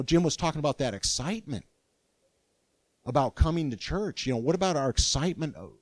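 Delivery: ordinary talk, nothing marked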